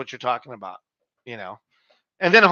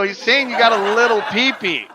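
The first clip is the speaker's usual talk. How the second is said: said through laughter, higher and lively; can barely hold the laugh in; explaining the joke to a buddy while laughing; laughing explanation; 6 semitones higher, 5 dB louder than usual. Retold or imitated. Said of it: "I go".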